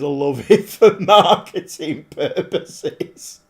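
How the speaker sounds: laughing through the words, slowed down. Real, not acted. Streamer laughing at a chat comment about marketing. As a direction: crack up while reading the comment. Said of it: "I don't know why I'm laughing".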